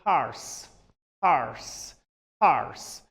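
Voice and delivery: American HORSE with the r sounded; American pronunciation. Shown as a demonstration.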